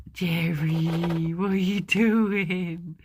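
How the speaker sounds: slow, cooing pet voice; drawn-out coo at the pet; pet talk. Real, not acted. Cooing slowly at Jerry the pet as he wriggles. Talking to her pet mid-video.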